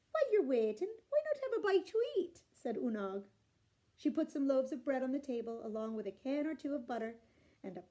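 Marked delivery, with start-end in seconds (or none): 0.1-2.4 higher, sugary wife; high sweet offering; sweet hostess. A narrator's reading. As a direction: lure him in sweetly. Unag sweetly offering food.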